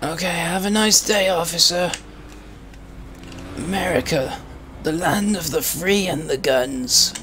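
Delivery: put-on a doddery old Briton protesting politely; old man voice. Old man voice denying to the officer.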